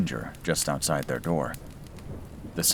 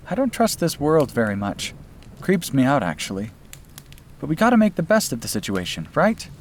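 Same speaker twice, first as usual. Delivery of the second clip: put-on higher, lightly teasing young woman; young woman's voice; 9 semitones higher, 3 dB louder than usual. Sister apologizes to brother for her cooking, higher teasing voice. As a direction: voice her apology with a playful edge.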